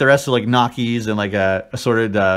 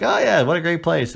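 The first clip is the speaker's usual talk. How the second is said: faked cool enthusiasm; 7 semitones higher than usual. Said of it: "trying to be cool and play it like".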